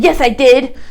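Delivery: put-on a child defiantly insisting to mommy and daddy; proud and haughty. Shown as a demonstration. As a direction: talk back like a proud child. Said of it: "this is proud and haughty".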